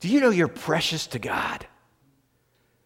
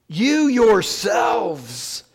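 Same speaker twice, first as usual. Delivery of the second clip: YOU YOURSELVES drawn out very slowly, pitched higher; emphatic; 4 semitones higher, much slower than usual. Retold or imitated. Preacher quoting Peter's emphatic statement, "You yourselves" slow and stressed. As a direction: lean hard on both words, "You yourselves". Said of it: "this is an emphatic statement".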